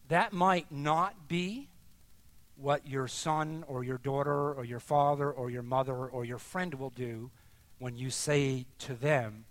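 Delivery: normal talking voice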